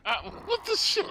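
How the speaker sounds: startled high cry of disbelief; shocked. Real, not acted. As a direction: caught totally off guard. Sudden ambush in game.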